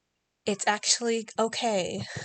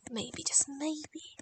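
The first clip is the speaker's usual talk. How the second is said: slow, breathy whisper of hope; 5 semitones higher, 5 dB quieter, slower than usual. Acted. Artist whispering hopefully to herself over her drawing, slow whisper. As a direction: breathe it out like a secret.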